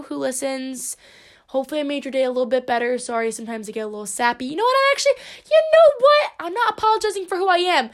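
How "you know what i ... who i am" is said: high, loud burst of defiance; mock indignation. Host retracting her sappy apology to listeners, mock indignant. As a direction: suddenly take it all back, quick and high.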